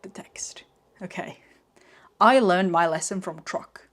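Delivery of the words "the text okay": unvoiced whisper; whispered aside. Real, not acted. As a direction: whisper it to yourself. Muttered aside while drawing.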